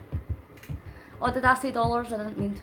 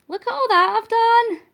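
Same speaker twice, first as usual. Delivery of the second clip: high, loud and proud; excited; 11 semitones higher, 6 dB louder than usual. Real, not acted. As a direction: crow about the achievement. Celebrating achievement.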